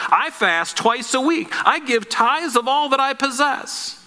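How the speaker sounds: lightly acted boastful prayer, pitched high; self-righteous Pharisee. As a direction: recite it high, like a proud list of merits. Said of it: "The Pharisee stood and prayed thus".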